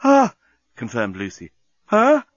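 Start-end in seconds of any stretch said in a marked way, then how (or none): 0.0-0.3 short, high, loud "ah"; passionate moan. Acted. Lucy moaning to the journalist, passionate cry. 1.9-2.2 high, loud questioning grunt; short gasp pitched up as a question. A narrator's reading. Journalist asking Lucy, high questioning gasp. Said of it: "asked the journalist again".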